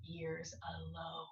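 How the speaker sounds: whispered secret to the ear; Onceler's secret whisper. Acted. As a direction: hush it like a secret. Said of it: "I will call you by whisper-mophone".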